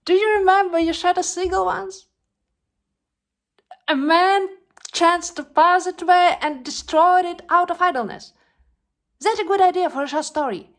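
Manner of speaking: loud, high, agitated ramble; distraught outburst. Acted. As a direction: raise your voice, stumbling to correct yourself. Distraught actress corrects herself, recalls seagull.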